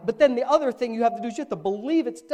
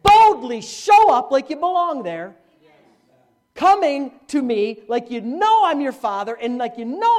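bold urging; 4 semitones higher, 8 dB louder than usual. Acted. God's voice paraphrased.

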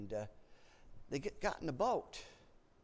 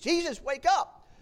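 high, loud, slow, pleading call; acted desperate shout to a sleeping man; urgent wake-up call; 13 semitones higher, 12 dB louder than usual. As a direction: shake him awake with your voice. Disciples shaking Jesus awake.

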